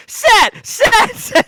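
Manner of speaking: put-on high, loud childish chant of a name; little girl cheering. Little girl cheering on her champion with a gleeful chant. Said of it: "You're like".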